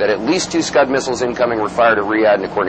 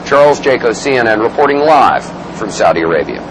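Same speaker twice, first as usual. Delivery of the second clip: news anchor sign-off; 3 semitones lower, 9 dB louder than usual. Acted. Second sign-off take.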